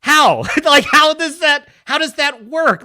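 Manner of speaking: loud, high baffled demand; incredulous outburst. Real, not acted. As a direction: burst out in amused disbelief. Baffled by sword in stone.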